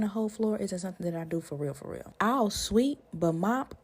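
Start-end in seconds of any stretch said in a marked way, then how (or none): 1.7-2.1 much softer throwaway repeat; muttered aside. Shown as a demonstration. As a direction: drop it under your breath. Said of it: "If I'm being honest".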